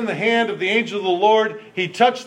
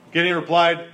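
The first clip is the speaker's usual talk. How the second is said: ordinary delivery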